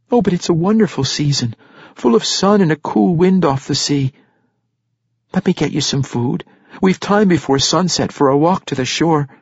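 fast, high, strained cheerfulness; forced cheer. A narrator's reading. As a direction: chirp it with false brightness. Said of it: "trying to sound as cheery as possible".

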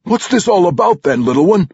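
high, loud gruff demand; angry demand. A narrator's reading. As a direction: demand an answer from the halfling. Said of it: "another man demanded".

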